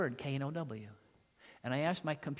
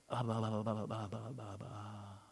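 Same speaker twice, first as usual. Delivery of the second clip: lower, softer, trailing mumble; mock evasive babble; dismissive blah; 5 semitones lower, 7 dB quieter than usual. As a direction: waffle off into nothing. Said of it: "the preacher's kid says".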